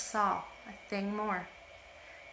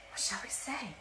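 hushed, anxious whisper; desperate whisper; 2 semitones higher, 7 dB quieter than usual. Acted. Sisters conferring secretly, desperate whisper. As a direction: whisper it in a panic. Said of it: "turned desperately to one another and whispered".

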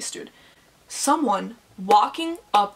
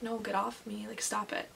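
imitated sleep talk; 13 dB quieter than usual. Friend pushing someone off in her sleep, murmured protest. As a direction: murmur it as if asleep. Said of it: "she's, like, trying to push someone off".

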